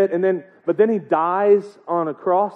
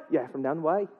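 softer Irish reply at an everyday pitch; mock Irish accent; 2 semitones lower, 9 dB quieter than usual. Seamus confirms which Patrick, quiet mock Irish accent. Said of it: "I'm gonna get that accent".

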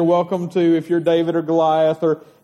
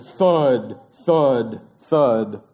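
deep, falling lower with each thud; heavy thuds; 7 semitones lower, much slower than usual. Acted. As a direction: stomp out each thud like a giant's footstep. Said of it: "Good job on the thuds".

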